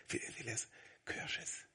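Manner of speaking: whispered, drawing out the suspense; teasing whisper. Retold or imitated. Father keeping his son on tenterhooks, teasing whisper. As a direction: whisper it to tease him.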